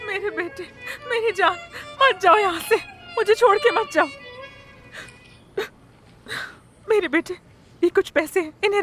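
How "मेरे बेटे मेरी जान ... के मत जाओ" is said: sobbing between short phrases; heartbroken, halting farewell plea; tearful pleading. Acted. Mother begs departing son to stay.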